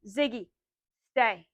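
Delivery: firm stay command to the dog. Shown as a demonstration. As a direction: say it like you mean it. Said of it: "say it in a pretty firm voice".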